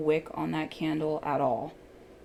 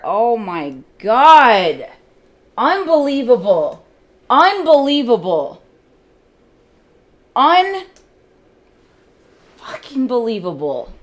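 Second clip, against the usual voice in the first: exasperated shout; 6 semitones higher, 13 dB louder than usual. Real, not acted. Trader raging in disbelief at the candle. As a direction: bellow your disbelief at the screen.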